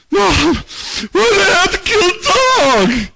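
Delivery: put-on high child's voice, pleading; tearful boy. Boy in Old Yeller asking his mom, high and tearful. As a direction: play the crying kid to his mom.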